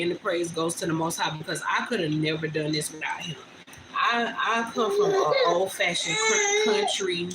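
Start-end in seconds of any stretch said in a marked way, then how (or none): 3.9-7.3 voice breaking. Real, not acted. Voice cracks while recounting.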